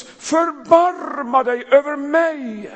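loud, high, slow cry for mercy. Acted. The blind beggar calling to Jesus over the crowd, high and loud. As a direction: call out across the crowd, drawn out. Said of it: "han ropar så det ljuder".

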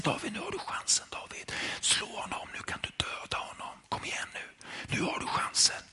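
fast urgent whisper. Acted. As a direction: egg him on under your breath, unvoiced. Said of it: "De viskar till varandra".

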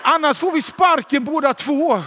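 högt, ilsket tillrop med ljusare röst; fräs åt kollegan att hålla tyst; lejonet i gapet. Acted. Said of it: "hör jag inifrån gapet där och citerar". (lejonets ilskna utrop)

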